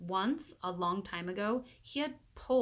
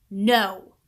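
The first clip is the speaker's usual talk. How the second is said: loud flat refusal; firm loud no; 13 dB louder than usual. A narrator's reading. Leslie refuses Paul, loud and firm. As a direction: slam the door on it.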